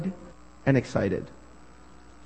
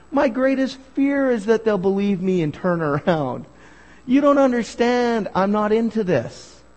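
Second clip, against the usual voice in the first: pitched-up indignant protest, acted out; mock-exasperated objection in a higher voice; Jonah objecting; 6 semitones higher than usual. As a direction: protest like a reluctant prophet refusing the job. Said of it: "How about Jonah?".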